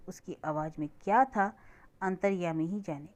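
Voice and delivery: ordinary delivery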